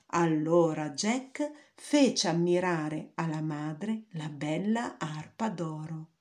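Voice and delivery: usual speaking voice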